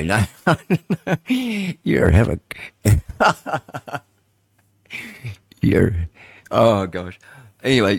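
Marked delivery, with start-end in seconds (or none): 0.6-1.8 high falling laugh; helpless high giggle. Real, not acted. Laughs while denying. 2.5-4.0 long falling laugh, higher; helpless laugh. Real, not acted. Cannot finish for laughing.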